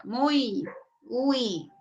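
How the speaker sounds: muy and uy stretched like a moo; long, mooed "muy, uy"; cow-like muy. Shown as a demonstration. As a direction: moo out the uy in muy, rising then gliding down. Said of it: "Parezco vaquita".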